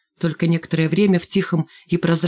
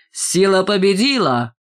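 slow, gleeful cheer in a put-on voice; slow gleeful chant; joyful cheer; 2 semitones higher than usual. A narrator's reading. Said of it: "радостно поддержал его Рыжий".

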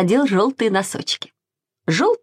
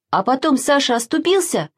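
usual speaking voice

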